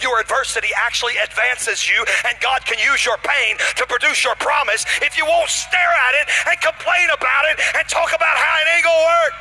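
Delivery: fired-up preacher in full cry; full-force preaching. Real, not acted. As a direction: drive the sermon up to a sustained, high shout.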